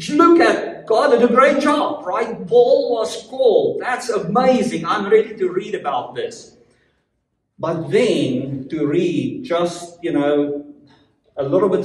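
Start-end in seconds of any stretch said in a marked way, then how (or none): 0.1-6.5 acted eager reader, raised pitch; amazed and keen, punching the praise; excited admirer. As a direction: gush like a thrilled fan of Paul. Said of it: "you are excited about what Paul".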